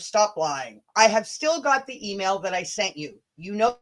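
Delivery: ordinary talk, nothing marked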